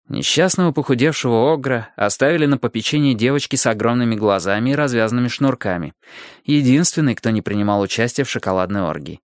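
ordinary delivery

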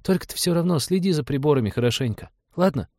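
put-on high-pitched child's request; pleading little boy. A narrator's reading. Boy asks pilot to watch instruments, earnest high voice. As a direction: coax like a small boy.

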